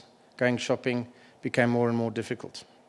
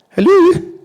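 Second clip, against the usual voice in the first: a much higher, much louder sing-song Scottish hello; exaggerated Scottish greeting, pitched way up and loud; broad Scottish accent; 17 dB louder than usual. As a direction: imitate the Scottish mum answering the phone. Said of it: "broad Scottish accent".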